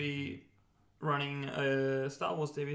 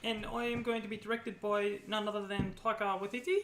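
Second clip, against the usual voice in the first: put-on New Zealand accent; 9 semitones higher than usual.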